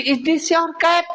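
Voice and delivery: "Is this your cap?" slow and pitched high; question intonation. Shown as a demonstration. Teacher demonstrating change of tone, high slow question. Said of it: "tone में बदलाव होना चाहिए".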